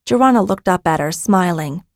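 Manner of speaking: plain reading voice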